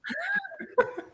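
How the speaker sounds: high-pitched giggle burst; high laughter. Real, not acted.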